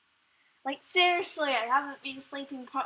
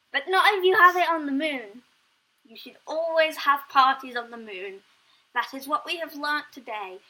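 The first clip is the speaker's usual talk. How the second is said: excited; 4 semitones higher, 11 dB louder than usual. Real, not acted. Joke punchline to the audience.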